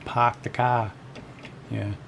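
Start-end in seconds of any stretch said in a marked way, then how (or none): 0.1-0.9 slow Bostonian demo with dropped R's; Boston accent. Shown as a demonstration. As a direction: imitate Boston, dropping the R in "park" and "car". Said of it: "They just dropped the R's".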